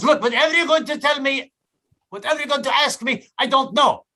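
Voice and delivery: high, insistent put-on foreign voice; irritated foreign attendant. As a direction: overrule the customer in mock exasperation. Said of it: "expect them to speak English".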